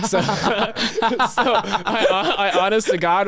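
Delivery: high, loud, laughing through the words. Real, not acted. Cracking up after calling it a blast, laughing. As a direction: struggle to start the sentence through laughter.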